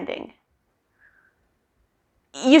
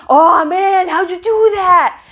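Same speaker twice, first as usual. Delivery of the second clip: mock amazed; 5 semitones higher, 5 dB louder, slower than usual. Amazed audience quoted.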